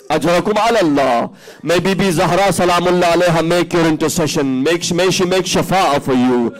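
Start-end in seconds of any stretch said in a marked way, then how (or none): none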